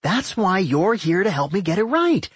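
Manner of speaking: high, chirpy, eager voice; cheerful. A narrator's reading. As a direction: say it cheerily, pitched well up. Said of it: "Doc said cheerfully".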